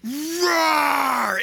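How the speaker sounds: long, mighty lion roar; Mufasa. Acted. Lion king defending his son, big sustained roar. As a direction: roar to send the enemy flying. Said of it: "there's this other roar that goes".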